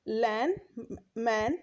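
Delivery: usual speaking voice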